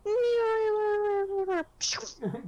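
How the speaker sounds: long high wail of a kicked goblin; cartoon wail as it flies away; mock flying wail. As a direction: imitate a tiny creature sailing off screaming. Acting out drop-kicked goblin flying.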